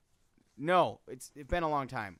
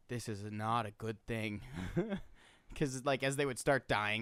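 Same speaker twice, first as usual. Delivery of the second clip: ordinary delivery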